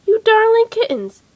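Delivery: high, loud delight; delighted mother cat. Acted. Mother cat praises the kittens, high delighted cry. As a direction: gush over the kittens.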